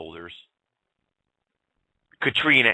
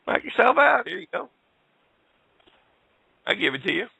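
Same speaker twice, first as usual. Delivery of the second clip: high, taunting "knock yourself out"; mock generous handover; 12 semitones higher than usual. Host taunting officials who want her twenty-two, high sarcastic voice. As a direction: offer it up with sarcastic sweetness.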